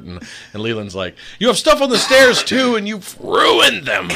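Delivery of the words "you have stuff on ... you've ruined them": high, loud mock shout, dragging out the last words; dramatic outrage. Brother accuses sister over stairs, dramatic mock outrage. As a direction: wail it like a hammed-up outraged kid. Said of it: "He's so dramatic".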